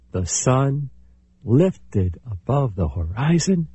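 ordinary delivery

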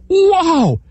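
high, sharp yell of pain; man bitten by turtle cries out; startled shout. Acted. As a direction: yelp as the leg gets bitten. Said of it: "shouted the man".

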